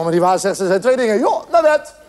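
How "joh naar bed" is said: higher-pitched parental dismissal; acted end-of-discussion order; exasperated mother. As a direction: send the child off to bed impatiently. Mother cuts off arguing son.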